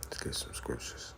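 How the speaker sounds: hushed, voiceless whisper; whispered aside. Real, not acted. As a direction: whisper it privately to your helper.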